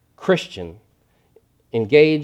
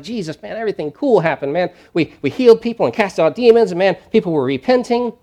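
excited disciples; 7 semitones higher, 5 dB louder than usual. Retold or imitated. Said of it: "And they're like".

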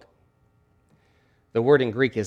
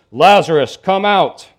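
loud, ringing command; loud calling voice; 4 semitones higher, 10 dB louder, slower than usual. Acted. Preacher voices Jesus calling Lazarus from the grave, loud call. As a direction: call out forcefully to summon someone. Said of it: "Jesus called in a loud voice".

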